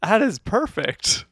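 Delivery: high, loud, stretched-out defense; slow, emphatic high-pitched insistence; playful insistence. Real, not acted. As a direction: champion the pun, loud and high. Playful dispute over punny title.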